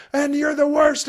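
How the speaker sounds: put-on high, loud child yelling; angry child. Child yelling at parent mid-repeat, high and loud. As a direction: blurt it like a furious kid. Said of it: "child interjects".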